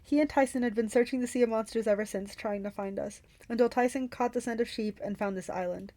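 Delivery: plain reading voice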